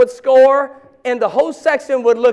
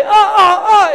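higher, louder excited oh-oh; eager response; 9 semitones higher, 6 dB louder than usual. Pretend. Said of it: "is better than silence".

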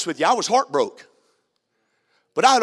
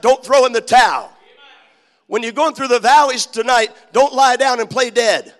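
louder, driving preacher's exhortation with shouted peaks; preaching; 5 semitones higher, 6 dB louder than usual. Acted. Preacher urging the congregation not to give up, preaching hard. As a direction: hammer each line home like a revival call.

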